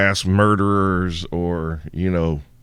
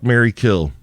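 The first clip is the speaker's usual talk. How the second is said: ordinary talk, nothing marked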